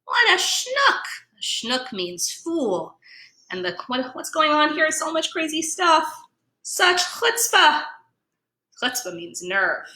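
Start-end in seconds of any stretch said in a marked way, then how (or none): 0.0-1.0 much higher, mocking voice; scoffing pickles. Pickles mocking the gefiltes at the deli, high. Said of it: "the pickles are saying". 4.1-7.8 higher, outraged tone; a shocked deli patron; indignant voice. Acted. Deli patron outraged at crashers, high.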